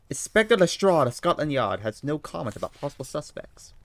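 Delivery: put-on high, loud London-accented Holmes; eager detective reading the news aloud. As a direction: play Holmes, keen and bright over the paper. Holmes voice continues.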